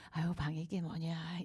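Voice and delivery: soft whispered elegant chiding with no anger; gentle, not angry. Retold or imitated. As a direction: ask about the messy room as gently as possible. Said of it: "우아한 말투로 화내지 않고".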